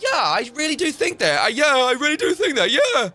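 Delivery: sneering parody of a leftist agreeing; mock leftist. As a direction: ham up an eager, naive yes-man. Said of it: "they'd be like".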